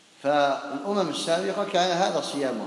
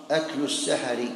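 ordinary talk, nothing marked

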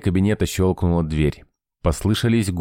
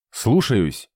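snappy yes sir; 5 semitones higher than usual. A narrator's reading. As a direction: snap to attention. Soldier acknowledges order.

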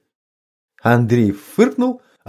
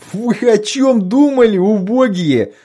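laughing; 8 semitones higher, 3 dB louder than usual. A narrator's reading. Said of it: "Утирая слёзы, выступившие от хохота, простонал Лёгкий".

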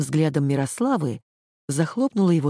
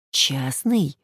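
a real, puzzled "Частный?"; honest astonishment at the word; sincere surprise. A narrator's reading. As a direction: ask it genuinely taken aback. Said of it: "искренне удивилась Петельнова".

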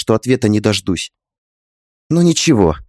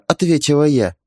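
ordinary delivery, nothing marked

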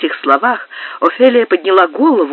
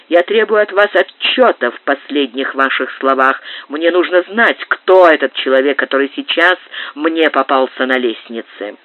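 plain reading voice

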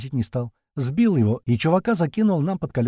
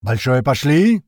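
shout; 7 dB louder, slower than usual. A narrator's reading. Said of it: "это уже орёт Апрель".

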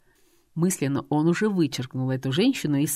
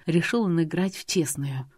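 usual speaking voice

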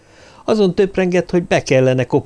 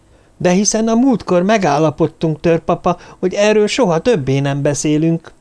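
higher-pitched dismayed protest, not really shouted; startled exclamation; 6 semitones higher than usual. A narrator's reading. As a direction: protest in astonishment. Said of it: "kiáltotta meghökkenve Darlin".

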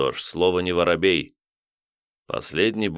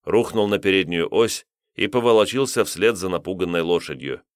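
plain reading voice

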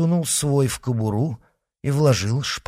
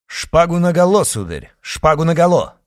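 loud, high call to draw swords; ringing dare, higher and louder; challenging shout; 7 semitones higher, 7 dB louder than usual. Acted. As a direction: bark the challenge at him. Challenging rival to fight.

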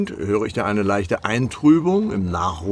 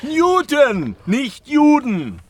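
emphatic correction; 12 semitones higher, 4 dB louder, much slower than usual. Acted.

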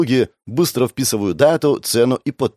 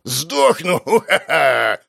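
put-on cackling old man; 7 semitones higher, much slower than usual. Old man rejoicing at death.

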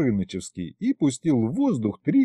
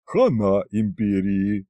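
slow, voiced dragon prophecy; doom-saying dragon head; 3 semitones lower, slower than usual. A narrator's reading. Dragon's middle head predicting disaster, slow and ominous. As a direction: pronounce doom slowly as a dragon. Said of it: "предсказала средняя".

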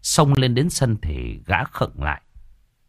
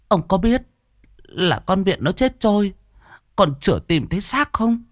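high, stammering questions; aghast woman tripping over her first words; horrified wife; 7 semitones higher than usual. A narrator's reading. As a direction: question him in high-pitched, halting alarm.

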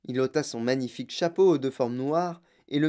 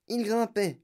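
"il grimpait" lifted much higher than usual; climbing pitch; 8 semitones higher than usual. A narrator's reading. Narrator follows the lift climbing ever upward, "il grimpait" pitched high.

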